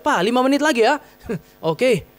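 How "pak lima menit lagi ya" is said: mild mock yell of a child, higher pitch; anak berteriak. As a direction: call out to dad, imitating a pleading child. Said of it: "dia akan teriak". (menirukan teriakan anak, nada agak tinggi)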